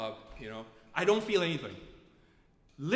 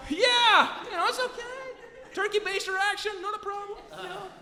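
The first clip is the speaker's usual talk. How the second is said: very high, chirpy agreement; comic, over-easy go-ahead=; mock nonchalance; 10 semitones higher, 4 dB louder than usual. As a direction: squeal consent as if it were nothing. Brightly accepting the procedure.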